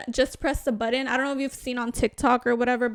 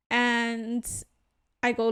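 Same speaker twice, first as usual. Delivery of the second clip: ordinary talk, nothing marked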